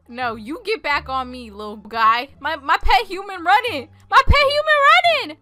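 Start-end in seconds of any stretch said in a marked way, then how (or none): 2.4-3.9 alarmed. Acted. Pet human runs away. 4.1-5.4 high, loud, frantic cry; panicked yelling. Acted. Player sees their pet human running off, panicked yelling.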